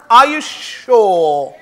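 slow, stretched mock doubt; drawn-out teasing. Shown as a demonstration. Teller teasing a doubtful audience, stretched slowly. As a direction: drag it out to tease the kids. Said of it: "You sound doubtful".

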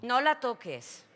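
loud and slow, stern; firm command. Acted. Ana stops María from touching the grandmother, loud and slow. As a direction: stop her with a firm order.

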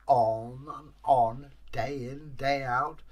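ordinary delivery

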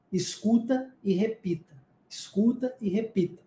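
soft, measured instruction; quietly spelled-out advice; hushed instruction. Shown as a demonstration. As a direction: drop the volume and repeat it like a mantra. Modelling the learning method softly.

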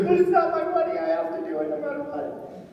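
high-pitched, earnest imitation of the donor; eager donor quote. Pastor voices the donor's insistence to the church, high and earnest. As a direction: play the compelled giver, pitch way up. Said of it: "He goes".

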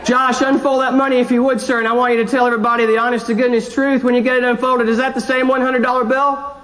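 usual speaking voice

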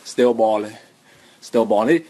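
low, lazy mumbled drill ad-lib; deep, soft rapper mumble. Retold or imitated. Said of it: "I'ma [name] style stunt on y'all".

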